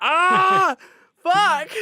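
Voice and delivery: loud, high pained curse; pained outburst. Retold or imitated. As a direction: curse as the earphones stick. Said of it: "you're just like".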